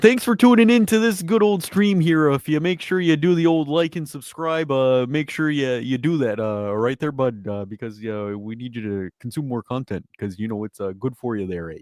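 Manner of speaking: hammed-up Canadian accent, starting loud and high, then easing down; Canadian accent outro. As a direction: sign off the stream like an over-the-top friendly Canadian, eh. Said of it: "I can do the outro in Canadian".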